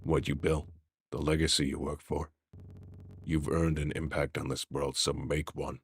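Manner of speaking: very low, soft voice; hushed urging. Acted. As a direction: urge them on quietly, kept right down.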